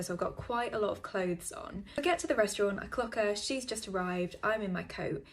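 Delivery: normal talking voice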